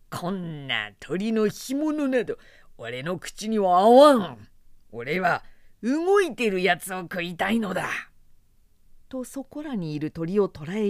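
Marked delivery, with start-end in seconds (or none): 0.1-7.9 put-on rough drunk warrior. A narrator's reading. Drunk Zhang Fei scorns food.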